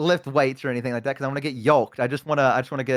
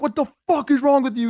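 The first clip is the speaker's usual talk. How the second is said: high, indignant mock yell; retold yelling; 10 semitones higher than usual. Gym manager scolding a sick customer, retold yelling. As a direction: act out the manager telling you off. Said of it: "he's yelling at me".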